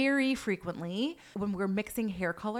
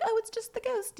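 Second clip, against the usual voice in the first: light, high-pitched brush-off; joking; 13 semitones higher than usual. Retold or imitated. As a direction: toss it off as a running joke. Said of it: "We've jokingly are like".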